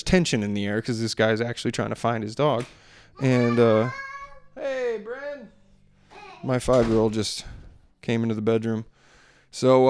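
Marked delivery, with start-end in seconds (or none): 4.5-5.5 gentle aside. Real, not acted. Speaking aside to young daughter.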